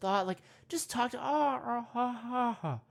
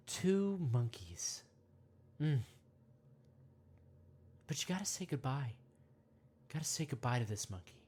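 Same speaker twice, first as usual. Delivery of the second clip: guided meditation; 5 semitones lower than usual. Acted. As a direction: ease the listener in gently, mock-serene.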